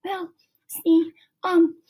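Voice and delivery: high, halting nervous stammer. A narrator's reading. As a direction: stumble over the words, embarrassed. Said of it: "stammered Colleen".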